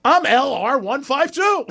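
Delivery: high, loud put-on voice giving his account number; hammed-up high voice; mock client voice. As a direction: say it like a proud regular customer. Client gives account number, laughing.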